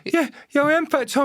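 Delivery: very high, squeaky retold agreement; mock-frightened, pitched far up; nervous eager reply. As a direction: agree hastily in a scared high voice. Retold relieved, scared reply to gang.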